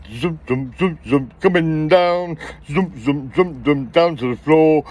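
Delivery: sing-song zoom, zoom, going down; mock zoom sounds. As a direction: imitate the trousers zooming down. Mimicking trousers sliding down.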